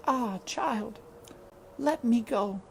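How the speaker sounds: put-on mother asking her child to release her; dying mother.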